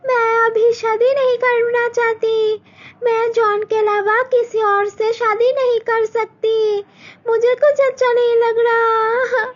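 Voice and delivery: put-on high, crying girl alone at night; tearful lament. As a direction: sob out her despair. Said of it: "मालती उसके room में बैठ के रोने लगती है".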